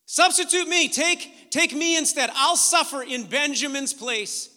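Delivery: loud, high acted plea; impassioned plea. Judah offering himself in Benjamin's place, impassioned plea. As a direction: cry out the offer with urgency.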